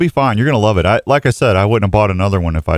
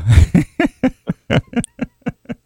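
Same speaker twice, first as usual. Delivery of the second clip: high giggle; 14 semitones higher than usual. Real, not acted. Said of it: "Gomer Pyle coming out of you".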